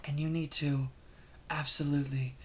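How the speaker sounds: very soft, low near-whisper; hushed, low advice to the ladies; mock microphone confidential. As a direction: murmur intimately into a pretend microphone. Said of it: "Let's imagine this was a microphone".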